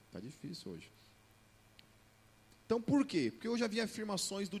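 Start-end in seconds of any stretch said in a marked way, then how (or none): 2.7-4.6 emphatic raised voice. Acted.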